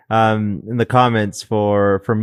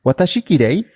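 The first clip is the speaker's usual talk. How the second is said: put-on a light Japanese accent, a bit higher and asking; 5 semitones higher, faster than usual. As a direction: voice the ghost with a gentle Japanese accent. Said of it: "You did a good Japanese accent there".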